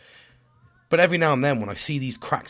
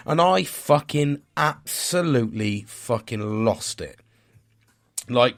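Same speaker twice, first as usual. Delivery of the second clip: usual speaking voice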